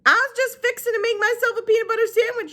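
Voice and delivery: put-on high, far-off old woman voice; Gloria Dump voice. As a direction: pitch her up as an old lady. She said.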